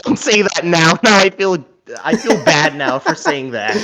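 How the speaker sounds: giggling through the words, pitch up high; laughing. Real, not acted. Co-host laughing at his own remark about the name. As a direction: laugh it out, half embarrassed.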